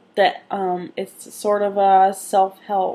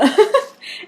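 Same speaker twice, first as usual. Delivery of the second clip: laugh; 13 semitones higher, 4 dB louder than usual. Real, not acted.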